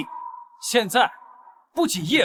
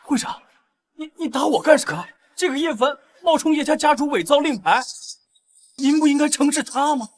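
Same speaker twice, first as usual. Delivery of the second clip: higher, stammering indignation; aggrieved, raised-pitch appeal; shocked protest; 6 semitones higher than usual. Acted. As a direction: protest an unexpected slap. Slapped, protests to the chairwoman.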